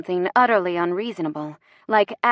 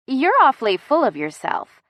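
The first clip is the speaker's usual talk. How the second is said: higher, emphatic put-down; indignant; 6 semitones higher, 4 dB louder than usual. Acted. Woman rebuking his cockiness, indignant. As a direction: tell him off with punched stresses. Heroine rebukes the cocky man.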